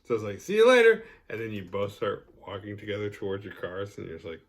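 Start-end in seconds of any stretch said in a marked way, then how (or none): 0.4-1.0 a much higher, louder second goodbye; high, loud awkward goodbye. Retold or imitated. Saying goodbye again to a friend, high and loud. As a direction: force the cheer on the same goodbye.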